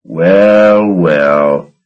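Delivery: drawn-out, satisfied "Well, well"; gloating repeat. A narrator's reading. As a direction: savour each "well" like a victory. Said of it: "he repeated".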